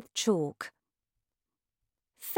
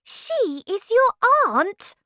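much higher, louder, slow disbelief; astonished girl; 7 dB louder, slower than usual. A narrator's reading. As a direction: ask it as if you can't believe it. Girl shocked by the news.

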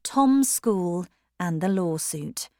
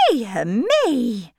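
higher startled "Dear me!"; surprised cry; 5 semitones higher, 4 dB louder than usual. A narrator's reading. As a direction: caught off guard by his words. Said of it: "cried Missus Tulliver again in surprise".

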